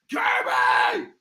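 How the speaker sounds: cracked, drawn-out mock yell; strained holler. Host clowning with a viewer's name, strained holler. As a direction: squeeze the name out in a broken shout.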